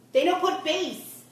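slow, high and loud put-on nail-tech voice; imitating Kim. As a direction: draw each word out high and loud, mimicking Kim. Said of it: "She goes".

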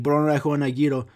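quick Greek phrase with tapped r; speaking Greek. Shown as a demonstration. Showing how to order from a Greek person, speaking Greek. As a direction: order in Greek, gyro as YEE-ro. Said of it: "if I'm talking to a Greek person".

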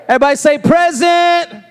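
high-pitched rallying call. Real, not acted. Preacher asks everybody to say present, calling out. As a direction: lead the crowd in answering.

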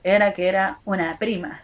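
normal talking voice

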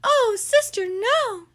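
high, loud plea; pleading Baby. Acted. Baby begging her sister not to call, high plea. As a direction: beg your sister to stop.

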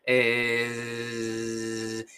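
long low hum closing into a buzz; throat sound into z. Shown as a demonstration. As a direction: hold "eh" and let it turn to zzz. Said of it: "vas a notar eso". (sonido de garganta que acaba en zumbido)

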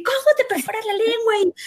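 high, indignant outraged question; angry retort. Retold or imitated. Angry sister scolding brother about his tongue piercing, high and indignant. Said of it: "Y yo me enojé y le dije".

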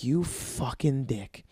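slow, quiet mutter under the breath. Retold or imitated. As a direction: grumble it to yourself. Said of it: "And I'm like".

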